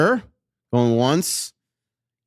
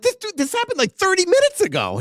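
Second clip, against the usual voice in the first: incredulous. Retold or imitated. Retelling disbelief at colleague's speed.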